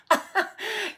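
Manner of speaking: loud high hooting laughter. A narrator's reading. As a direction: hoot with mocking laughter. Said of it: "Her sisters hooted so hard".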